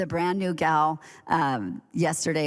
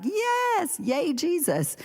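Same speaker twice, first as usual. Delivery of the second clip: imitating a squealing high yay; excited cheer; 13 semitones higher, much slower than usual. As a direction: mimic Lori's high-pitched joyful cheer. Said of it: "Lori's like".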